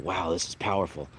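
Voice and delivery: ordinary delivery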